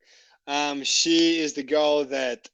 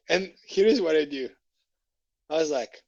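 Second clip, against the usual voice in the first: ordinary talk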